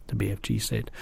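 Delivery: ordinary delivery, nothing marked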